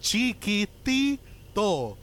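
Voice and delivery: Chiquitito said very slowly, every syllable clear; slow emphatic Chiquitito with t. Shown as a demonstration. Insisting on the Puerto Rican word, slow emphatic Chiquitito. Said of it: "we say chiquitito".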